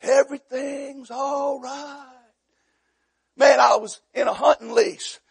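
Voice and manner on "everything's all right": slow, drawn-out put-on assurance; stretched, unconvincing all-clear; mock reassurance. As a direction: imitate someone hiding trouble. Family pretends nothing is wrong.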